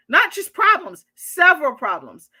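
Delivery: "not just problems" pushed high and loud, then "several problems" stressed. Real, not acted. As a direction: lean hard on "not just" to show outrage. Stressing the therapist's insult.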